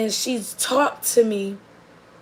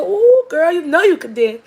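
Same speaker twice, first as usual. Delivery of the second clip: higher and louder, a thrilled squeal of praise; gushing excited praise; 7 semitones higher, 7 dB louder than usual. Retold or imitated. An older lady at the gas station gushes to the dancer, a loud thrilled squeal. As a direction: ooh girl, hyped-up delighted auntie.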